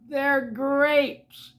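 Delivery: high, loud, drawn-out announcement; singled-out, excited punchline; gleeful reveal. Acted. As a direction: reveal it with bright surprise. Character reveals the joke.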